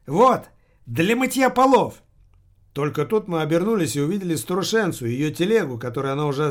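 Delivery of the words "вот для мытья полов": put-on high, loud, peppy old woman; бодрая старуха. A narrator's reading. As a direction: call it out cheerily like a perky granny, drawn out. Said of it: "бодрый старушечий голос". (бодрый старушечий голос, громко и высоко)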